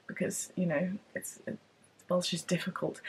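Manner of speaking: hushed, breathy confiding whisper; whispered aside. Real, not acted. Vlogger whispers to viewers that Welsh is tricky, whispered. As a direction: drop to a whisper as if sharing a secret.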